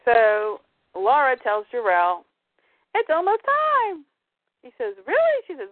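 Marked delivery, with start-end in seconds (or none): 2.9-4.0 high, held-out singsong; singsong announcement. Acted. Podcaster as Lara telling Jor-El, singsong announcement. Voicing the expectant mother. 5.0-5.4 high, squeaked-up question; mock surprise. Podcaster as Jor-El hearing the news, mock surprise.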